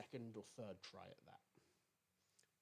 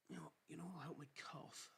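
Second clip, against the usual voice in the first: whispered aside; 5 semitones higher, 4 dB quieter than usual. Real, not acted. Joking aside about the cough.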